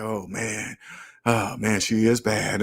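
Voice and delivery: soft, low, awed oh man; awed murmur. Retold or imitated. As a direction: murmur to yourself, impressed and shy. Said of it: "I wouldn't say anything".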